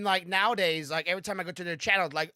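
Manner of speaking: normal talking voice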